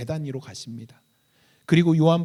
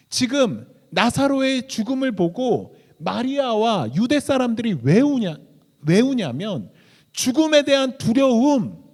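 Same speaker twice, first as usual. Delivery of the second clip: loud, high-pitched sermon delivery; raised preacher's voice, punching key words; forceful preaching; 7 semitones higher, 8 dB louder than usual. Acted. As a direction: drive each phrase home at full preaching force.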